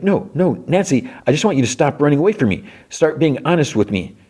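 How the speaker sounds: fast, high, exasperated pleading; mock pleading protest. Recapper voicing Jonathan begging Nancy for honesty, high fast mock plea. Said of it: "He's like".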